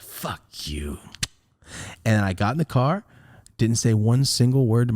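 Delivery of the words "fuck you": low, soft, seething curse; silent resentment. Acted. Angry son looking at mom, quiet resentment. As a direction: say it under your breath, low and bitter. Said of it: "That's how I felt".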